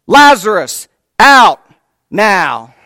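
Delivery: booming high call of Lazarus out now; loud command. Acted. Jesus commands the dead man out, booming shout. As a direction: shout it into the tomb. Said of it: "That's the exact words that it uses".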